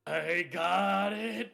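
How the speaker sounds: slow, high, loud holler; loud drawn-out call. Acted. Fielder diving to catch the meteorite, loud drawn-out call. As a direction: holler it long and stretched out.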